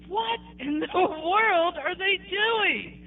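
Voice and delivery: high, drawn-out incredulous reaction; retold disbelief. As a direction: stretch it out high, replaying your bafflement. Acting out shocked reaction to the ritual.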